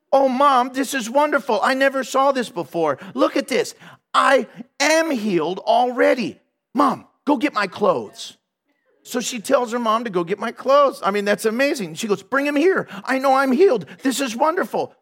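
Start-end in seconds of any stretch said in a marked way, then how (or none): 0.1-8.4 high, joyful excited voice; tearful joy. Retold or imitated. Healed daughter telling her mother the news, high and joyful. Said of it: "With tears flowing down her face and joy growing". 12.2-14.9 high, bright certainty of healing; joyful insistence. Retold or imitated. Girl insisting to her mother she is healed, high.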